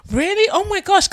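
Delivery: high, loud excited gush; squealed-up astonishment; mock amazement. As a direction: imitate a stranger thrilled by what they hear. Retelling strangers' amazement at accent.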